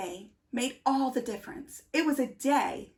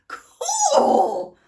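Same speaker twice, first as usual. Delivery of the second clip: very high, loud gushing "Cool."; peak awe; 8 dB louder than usual. A narrator's reading. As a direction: squeal it like the ultimate wow. Awe peaks at the jumping bean.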